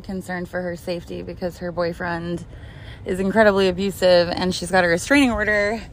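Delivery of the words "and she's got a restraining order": normal talking voice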